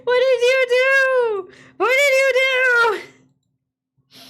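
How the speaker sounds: high, slow, loud wail; drawn-out crying wail. Real, not acted. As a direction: wail it out in despair. Wailing at the screen.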